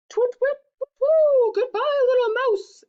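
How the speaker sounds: very high, loud hooting; panicked owl. Acted. Owl flaps away from the mouse, loud hoots. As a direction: hoot off in a flap.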